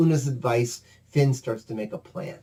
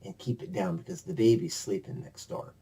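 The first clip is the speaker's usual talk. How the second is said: hushed; 5 semitones lower, 7 dB quieter, faster than usual. Acted. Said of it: "would you please just be quiet".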